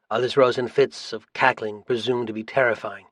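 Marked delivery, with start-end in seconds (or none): none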